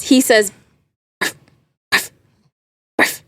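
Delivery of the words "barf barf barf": three tiny breathy dog barks, spaced apart; whisper barks. Shown as a demonstration. Showing the hosts how the dog barks, whispered barks. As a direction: imitate an old dog's short whispered woof. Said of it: "He whisper barks".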